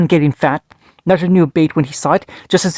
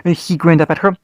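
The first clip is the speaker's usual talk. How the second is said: ordinary delivery, nothing marked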